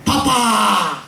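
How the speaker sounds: high, loud mock yell of "Papa"; imitated shout, pitched high and drawn out; retold loud cry. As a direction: cry out like someone calling for dad. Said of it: "Saya dengar teriakan keras, keras sekali".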